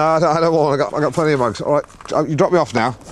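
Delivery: normal talking voice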